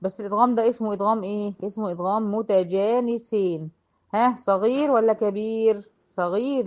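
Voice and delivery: usual speaking voice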